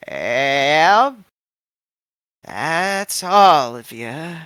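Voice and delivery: put-on slow, wavering old woman's drawl; frail old lady. GM voicing an old woman greeting the party, slow and frail.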